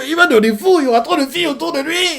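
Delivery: mock swooning Sanji; giddy excitement. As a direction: squeal it like a love-struck fan. Sanji going wild over girls.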